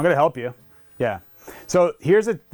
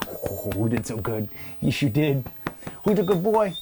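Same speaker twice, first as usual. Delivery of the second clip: softer, tender praise to the dog; affectionate praise; 3 semitones lower, 5 dB quieter than usual. Real, not acted. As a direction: coo the affection at him. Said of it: "bring the affection to him".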